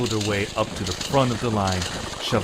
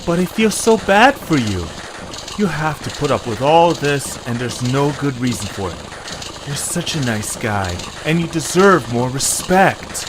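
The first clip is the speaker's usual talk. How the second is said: a touch higher, earnest and caring; Eche sincere; 4 semitones higher, 5 dB louder than usual. Acted. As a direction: comfort a bullied friend with feeling.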